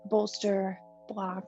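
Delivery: usual speaking voice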